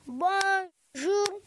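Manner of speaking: "Bonjour" slowly cut into two beats, "Bon" then "jour"; "Bonjour" split as "Bon" and "jour"; syllables split. Shown as a demonstration. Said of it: "combien de syllabes dans bonjour".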